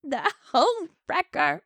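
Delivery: high-pitched melodramatic complaint; hammed-up scorned widow. Widow ranting about the homewrecker.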